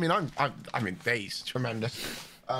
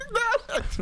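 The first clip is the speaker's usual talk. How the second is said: laughing. Real, not acted. Cracking up at wrong answers.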